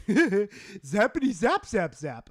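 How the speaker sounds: laughing cartoon-character imitation. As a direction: mimic a cartoon goof while laughing. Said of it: "like, fucking laughing".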